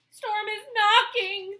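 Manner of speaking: high, loud cry of fear; frightened cry. Acted. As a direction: cry it out scared. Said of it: "the sheep cried".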